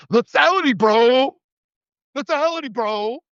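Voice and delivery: high-pitched mock bro chant; hammed-up gung-ho bro, stretching the words; military bro. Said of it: "all you military bros who love".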